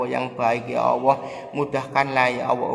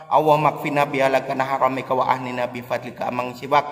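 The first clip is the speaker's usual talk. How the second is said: fast prayer recital; 4 semitones higher, 7 dB louder, faster than usual. Acted. Preacher repeating the prayer for viewers, fast and loud. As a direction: rattle the prayer off quickly and loudly.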